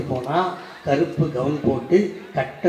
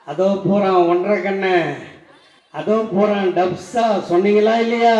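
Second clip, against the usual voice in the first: lightly acted street taunt, raised voice; mock jeering call; 5 semitones higher, 6 dB louder than usual. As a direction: call it out like a mocking bystander. Acts out street taunts, challenges audience.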